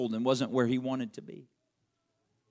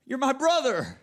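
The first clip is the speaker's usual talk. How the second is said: anguished plea; 8 semitones higher, 4 dB louder than usual. Acted. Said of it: "pleading anguish of his soul".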